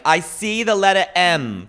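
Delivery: airy psychic medium voice; mock psychic. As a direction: play the vague fortune teller.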